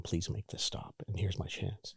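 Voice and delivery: hushed, barely voiced inner plea; retold quiet plea. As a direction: plead under your breath. Retold plea to himself in bed.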